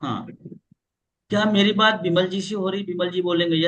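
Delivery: ordinary talk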